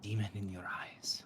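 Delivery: lightly voiced mystic, slow and hushed in places; a cryptic oracle studying a stranger; a hint of an otherworldly prophetess; eerie seer woman.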